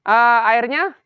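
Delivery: put-on chirpy child asking about coffee; child's voice. Grandpa voicing the grandchild asking about coffee, high.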